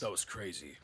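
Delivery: low, soft understated remark; mock unfazed veteran; shrugging it off quietly; deadpan calm. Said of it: "just standing there like".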